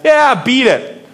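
grumpy brush-off. Retold or imitated. Tired fishermen to a stranger on the beach, mock grumpy brush-off. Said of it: "I'm sure they said".

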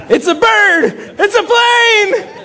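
high, slow, hammed-up shouts of wonder; mock overexcited shout. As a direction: gush like the bird is a miracle. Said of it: "so excited about".

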